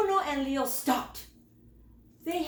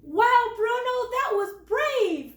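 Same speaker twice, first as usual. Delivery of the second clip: much higher, louder wow; impressed; 10 semitones higher, 6 dB louder than usual. A narrator's reading. Leo praising Bruno after the snake, excited and high. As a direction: gush over the brave deed. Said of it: "said Leo".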